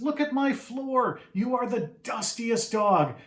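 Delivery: a fussy mother tutting over dirt; exasperated mom. Acted. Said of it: "And the mom says".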